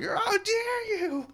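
very high, slow wailing "how dare you"; hammed-up indignant cry; mock outrage. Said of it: "my anger is rising. I'm like".

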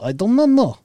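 high put-on broken-English voice; goofy accent. Host riffing about insulin comas in a high goofy accent. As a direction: ham up a silly foreigner.

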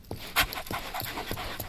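long breathy sigh of pleasure; contented sigh. Acted. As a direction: sigh out with pure comfort. Dog rolling on soft rug.

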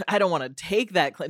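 normal talking voice

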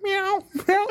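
very high, small cat voice; cat meowing. Retold or imitated. Cat heard in the dark, high imitated meow. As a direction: imitate a little cat's mew. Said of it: "sounds like a cat".

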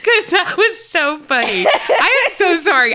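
giggling through words; can't stop laughing. Real, not acted. Laughing fit.

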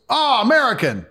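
put-on high, taunting foreign accent; mocking local man. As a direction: sneer it like a drunk local thug. Drunk Moldovan man taunting them.

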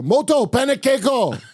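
high-pitched yell in mock Japanese; hammed-up high yelled food order; imitated movie yell. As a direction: holler it like the Inherent Vice character, way up high. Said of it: "I yell like, uh, in, uh, Inherent Vice".

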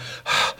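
heavy sigh of relief after the act; exhausted sigh. Retold or imitated. Dad once the man has passed, exhausted sigh. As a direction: let out a worn-out breath. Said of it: "this is exhausting".

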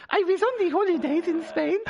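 put-on high [name] accent, laughing through it; giggling [name] voice; [name] impression. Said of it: "I was gonna ask you to do an accent".

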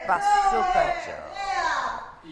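high, loud, long-drawn nickname call; announcing shout. Real, not acted. Host introducing guest to crowd, announcing shout. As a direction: belt it out like a ring announcer.

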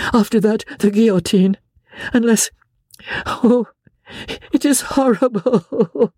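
a distressed wife begging her husband; Marguerite pleading. A narrator's reading.

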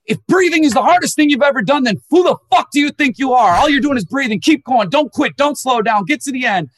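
high, loud mock drill-sergeant push; imitating a yelling meditation guide; pushing coach yell. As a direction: bark them on like a coach who won't let you quit. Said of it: "someone sitting there going".

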